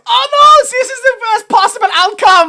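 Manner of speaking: high, loud melodramatic wail; quoting John Boy's line as an over-the-top cry; hammed-up despair. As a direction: shout it like overacted tragedy. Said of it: "I think his exact line is".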